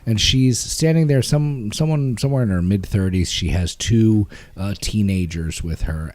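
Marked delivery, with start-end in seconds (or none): none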